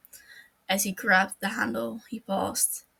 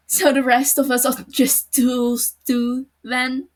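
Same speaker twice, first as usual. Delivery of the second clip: loud, bitter accusation; bitter retort; 4 semitones higher, 11 dB louder than usual. Acted. Matsuda accusing L he's only a tool, bitter and loud. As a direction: throw the words back at him.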